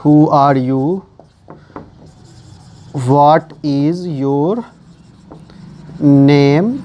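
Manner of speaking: slow, drawn-out words with long pauses; spoken piece by piece as it is written; dictating while writing. Real, not acted. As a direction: stretch the words to the pace of writing. Writing example sentences while saying them.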